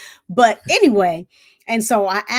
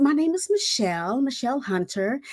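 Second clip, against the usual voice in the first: higher, dainty self-introduction; prissy; 4 semitones higher than usual. Retold or imitated. New recruit introduces herself to a screaming sergeant, prissy. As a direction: play up a prim little miss. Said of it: "And I was Prissy".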